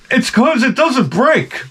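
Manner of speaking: high, slow, prissy put-on voice; mock the smug tradesman's explanation, way up high and slow; hammed-up smug carpenter; mock know-it-all. Mocking the carpenter's know-it-all reply.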